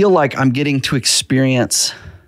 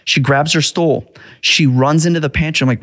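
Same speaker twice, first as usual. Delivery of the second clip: ordinary talk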